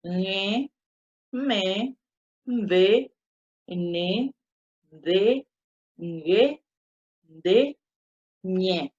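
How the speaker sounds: cada sílaba nasal con "e", separada; "Ñe, me, mbe" con resonancia nasal; consonantes nasales. Shown as a demonstration. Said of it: "Ocho consonante nasal". (recitado pausado de nasales)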